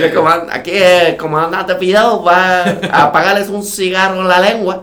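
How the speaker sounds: high, slow, loud military announcer; lightly voiced Cuban commander; mock commander voice.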